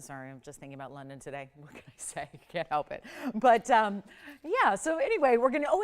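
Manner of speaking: normal talking voice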